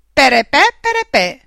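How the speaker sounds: high, loud tooted fanfare; cheerful little trumpet. A narrator's reading. Trumpet celebrating the found drawing, cheerful tooting imitation. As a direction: toot it like a merry toy trumpet. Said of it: "suonò un'allegra trombetta".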